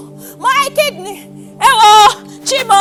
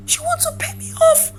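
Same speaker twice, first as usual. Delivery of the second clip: high, soft, wounded question; small, incredulous, near tears; hurt disbelief; 8 semitones higher, 5 dB quieter than usual. Acted. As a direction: repeat it as the hurt sinks in. Repeats it, hurt sinking in.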